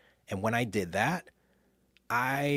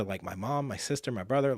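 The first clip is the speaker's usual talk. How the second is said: ordinary talk, nothing marked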